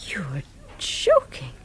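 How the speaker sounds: slow, breathless disbelief under the breath; stunned whisper. Acted. Stunned hushed reaction.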